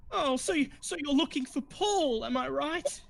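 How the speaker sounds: high, breathy after a fake sniffle; fake sniffly actress. Actress asks the party about Paul, high and sniffly. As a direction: sniff then ask sweetly, pitched high.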